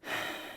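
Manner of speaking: slow audible breath; deep breath. Shown as a demonstration. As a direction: demonstrate a calming deep breath. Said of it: "just take a deep breath".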